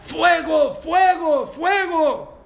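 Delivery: high, loud cry of fire; shouting fire. Retold or imitated. Rabbi crying out fire to stop himself from sinning. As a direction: yell it out in alarm, over and over. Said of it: "Empezó a gritar".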